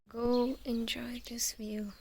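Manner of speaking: slow soft whisper. Real, not acted. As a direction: breathe it out gently. Soft sign-off to viewers.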